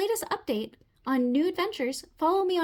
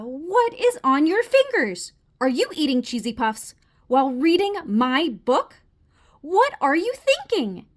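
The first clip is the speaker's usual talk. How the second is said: appalled scolding; 8 dB louder than usual. Acted. Frog catches reader eating over book, appalled. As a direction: scold in disbelief, stretching the words.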